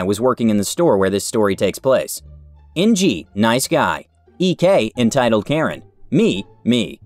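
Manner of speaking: usual speaking voice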